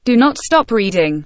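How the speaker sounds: normal talking voice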